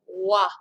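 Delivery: "wa" as the French OI vowel; "wa" sound. Shown as a demonstration. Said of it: "does not make an oi sound".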